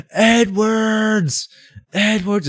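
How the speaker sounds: high, loud drawn-out shout of a name; mock stadium yell. Dudes in the stands yelling at the player. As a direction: call out across the field like a heckler. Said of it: "is screaming".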